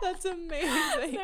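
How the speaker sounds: slow, high, laughing almost crying. Real, not acted. Co-host reacting to the D and D joke, laughing helplessly.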